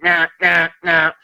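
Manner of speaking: imitating a stubborn client's high, dismissive "nah"; mimicked refusal. Skeptical client refusing the medium's evidence, mimicked high refusal. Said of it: "I hate to say it like that".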